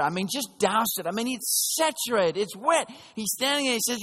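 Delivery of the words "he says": ordinary delivery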